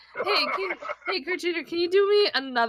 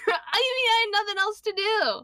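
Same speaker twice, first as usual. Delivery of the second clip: whiny protest; 8 semitones higher, slower than usual. Real, not acted. Defending herself amid teasing and laughter.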